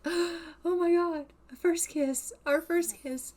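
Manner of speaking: high, slow, gasping surprise at a first kiss; lightly voiced breathless wonder; surprised. Sim surprised by her first kiss, high and amazed. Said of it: "She looks so surprised".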